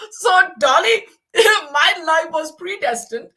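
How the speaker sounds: high, loud laughing through the words. Real, not acted. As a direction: talk while cracking up. Laughing at own fate.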